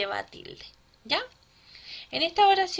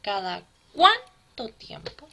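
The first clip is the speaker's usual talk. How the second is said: slow, loud "CUÁN" in "cuánto", broken off from "to"; stressed syllable in cuánto; 7 dB louder, slower than usual. Shown as a demonstration. Teacher reminds pupil of the stressed syllable, hammering the "cuán" in "cuánto". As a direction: stretch and punch the first syllable of "cuánto". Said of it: "La sílaba tónica".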